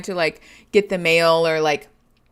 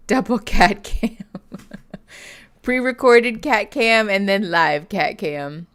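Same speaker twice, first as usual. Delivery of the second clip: ordinary talk, nothing marked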